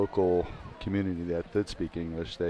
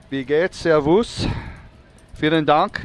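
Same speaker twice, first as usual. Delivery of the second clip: higher, louder, slow Bavarian German greeting; Bavarian German accent; 8 semitones higher, 10 dB louder, slower than usual. Acted. Co-commentator greets German basketball team in Bavarian German accent. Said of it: "my Bavarian American accent".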